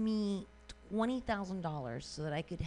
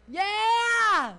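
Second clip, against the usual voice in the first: cheering shout; 13 semitones higher, 11 dB louder than usual. Real, not acted. Cheering the story.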